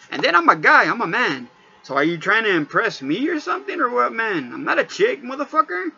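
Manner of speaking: ordinary delivery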